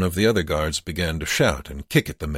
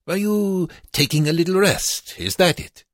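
put-on high, loud accented guard's challenge; taunting guard; 14 semitones higher, 4 dB louder than usual. A narrator's reading. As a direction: sneer it as a camp guard catching a slacker. Guard challenges resting prisoner.

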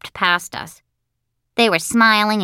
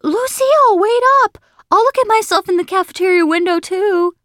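put-on very high, loud kid yell; squealing shout to someone walking off; excited boy's shout; 13 semitones higher, 6 dB louder than usual. A narrator's reading. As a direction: call after her eagerly. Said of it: "he began to shout".

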